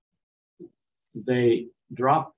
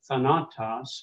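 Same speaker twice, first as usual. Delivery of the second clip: tha-NA-tos, middle syllable stressed in thanatos; misplaced middle stress. Shown as a demonstration. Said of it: "You, you wouldn't say".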